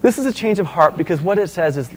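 usual speaking voice

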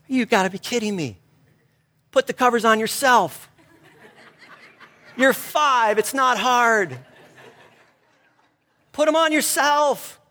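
high, exasperated inner groan with a laugh; fed-up dad who doesn't want to get up. Retold or imitated. Tired dad grumbling at his son's request, exasperated. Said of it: "I'm thinking".

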